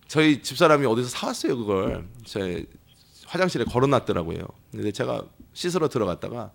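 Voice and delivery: ordinary talk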